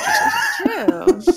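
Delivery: blurted out through laughter; laughing. Real, not acted. Teasing about another host.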